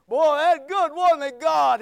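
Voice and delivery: high, booming pleased boast; loud self-congratulation. Retold or imitated. Young preacher to God about his first message, loudly pleased. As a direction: crow it loudly to heaven.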